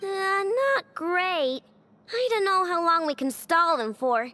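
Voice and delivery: usual speaking voice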